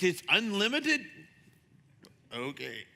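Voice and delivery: normal talking voice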